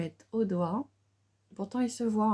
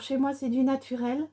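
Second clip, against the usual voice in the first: ordinary delivery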